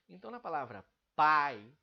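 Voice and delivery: pai punched loud, the A strong and the I faint; stress on pai. Shown as a demonstration. Teacher showing the falling diphthong in pai, loud on the A. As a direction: hit pai hard, let the I fall away. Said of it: "o A é muito mais forte do que o I".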